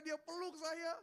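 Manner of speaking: ordinary talk, nothing marked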